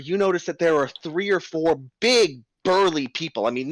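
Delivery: normal talking voice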